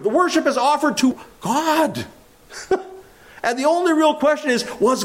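rising, building sermon voice; fervent pulpit preaching. Acted. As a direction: preach it with fire. Preaching at full force.

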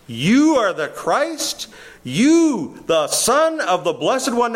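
"You" pitched high and stretched, slow and accusing; accusing emphasis. Acted. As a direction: lean hard on each "you" as you confront him. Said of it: "they are saying".

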